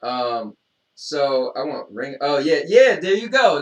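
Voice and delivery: normal talking voice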